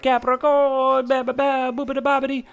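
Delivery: high gibberish mock Italian; Cake Boss. Mocking a TV baker's food list, silly Italian accent. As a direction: babble in an exaggerated fake Italian accent. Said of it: "put all his stupid accent on everything".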